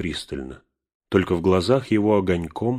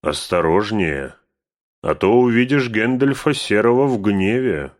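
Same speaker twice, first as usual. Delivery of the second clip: rising threat; 4 semitones higher, 7 dB louder than usual. A narrator's reading. Gandalf threatening Bilbo, rising to a wrathful boom. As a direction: let your wrath swell up.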